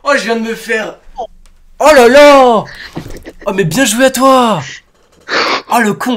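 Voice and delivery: high, flustered exclamations; real squealing disbelief; high-pitched voice. Real, not acted. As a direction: cry out high, thoroughly had. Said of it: "je commence à prendre ma voix aiguë".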